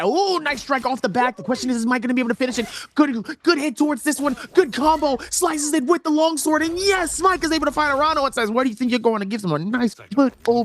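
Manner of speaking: high, rapid excited fight call; live play-by-play. Real, not acted. Caster calls the sword combo live, excited play-by-play.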